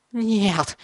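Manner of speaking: high, piercing no; desperate scream. A narrator's reading. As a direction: scream with all you have. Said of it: "Бен всё кричал и кричал".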